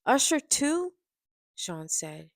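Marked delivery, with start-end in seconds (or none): 0.1-0.9 high, loud, incredulous outburst; appalled revulsion. A narrator's reading. Friend learning who is on the tapes, appalled outburst. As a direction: exclaim it as an appalled question. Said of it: "Kimora said with revolution".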